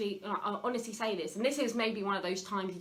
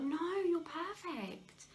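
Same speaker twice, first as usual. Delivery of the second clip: comforting parent; 8 semitones higher, slower than usual. Retold or imitated. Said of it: "you would be like".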